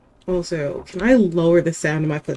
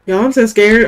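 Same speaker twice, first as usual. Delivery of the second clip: loud scared; 4 semitones higher, 7 dB louder than usual. Real, not acted.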